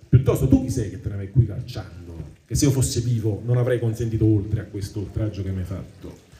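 ordinary talk, nothing marked

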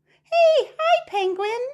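excited squeal. Acted. Cheery animal greeting rhyme.

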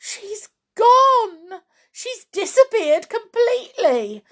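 high, loud wail of dismay; frantic woman's high-pitched shriek; distraught cry. Acted. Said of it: "cried Missus Cranky".

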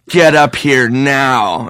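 slow, firm command; slow commanding order. Retold or imitated. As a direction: order him up like a dungeon master. Throne man orders Nick.

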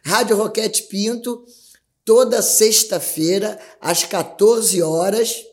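slow, loud radio plug; radio announcer. Acted. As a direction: announce it like on air.

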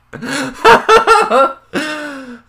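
loud high laugh; big laugh. Real, not acted. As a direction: burst out laughing. Laughing at own joke.

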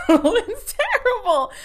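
high, slow laughing "terrible"; giggling disbelief at Lynn; laughing squeal. Real, not acted. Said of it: "laughing repeat".

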